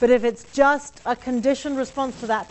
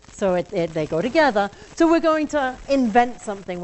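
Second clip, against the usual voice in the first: normal talking voice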